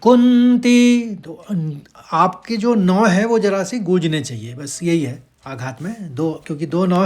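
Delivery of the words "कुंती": कुंती with a resonant nasal न, slow and higher; stressed syllable. Shown as a demonstration. Teacher shows students the stressed nasal in कुंती. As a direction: let the न in कुंती hum. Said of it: "कैसे होगा देखिए".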